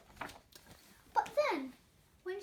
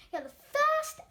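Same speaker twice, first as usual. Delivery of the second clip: excited praise; 8 semitones higher, 10 dB louder than usual. Acted. People praising glump excitedly.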